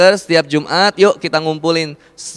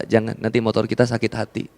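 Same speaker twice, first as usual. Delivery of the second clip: usual speaking voice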